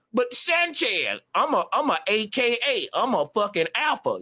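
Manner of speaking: mock whiny bragger. Mocking a man who brags to Sanchez, whiny and high. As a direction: pitch it up and whine the brag.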